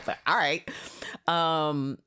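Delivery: ordinary talk, nothing marked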